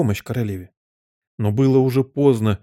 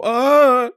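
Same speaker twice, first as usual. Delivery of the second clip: very high, long wail; piercing scream; 13 semitones higher, 6 dB louder than usual. A narrator's reading. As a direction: let out a loud anticipatory shriek. Queen screams in anticipation. (пронзительный крик)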